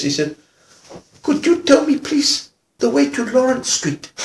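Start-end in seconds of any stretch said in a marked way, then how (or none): 1.2-4.1 high, meek, careful request; chastened man politely asking directions; hammed-up timid little voice; subdued. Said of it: "They much subdued".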